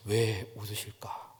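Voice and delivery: low, slow wondering murmur; puzzled murmur to himself. Retold or imitated. 웃는 성도들 앞에서 속으로 묻는, 낮고 느린 중얼거림. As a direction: mutter it softly, half to yourself. Puzzled inner thought in story.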